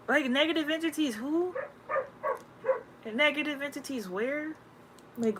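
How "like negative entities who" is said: ordinary delivery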